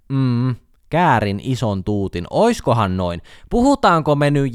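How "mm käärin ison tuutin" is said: käärin ison tuutin said slowly, musing on the double meaning; slow pondering repeat. Shown as a demonstration. Mulling over a rapper's lyric aloud, slow and knowing.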